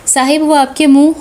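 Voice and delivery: plain reading voice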